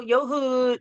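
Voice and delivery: ordinary talk, nothing marked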